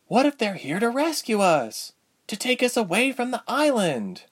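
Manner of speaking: high, eager, hopeful questioning; glimmer of hope. A narrator's reading. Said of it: "with a glimmer of hope".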